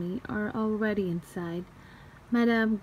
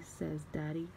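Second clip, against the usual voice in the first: ordinary delivery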